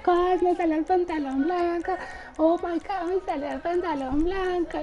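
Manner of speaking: retelling her thrilled cry to Cos, pitched high; retold excited cry. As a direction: squeal it high like the joy is coming back. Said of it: "yo hasta gritaba y le decía".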